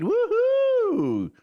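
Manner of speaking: high, loud "woohoo"; long high-pitched whoop of celebration; whooping cheer. Pretend. Mock cheer at warmer forecast.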